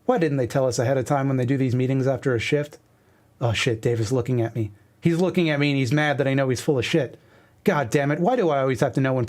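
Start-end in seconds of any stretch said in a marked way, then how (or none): none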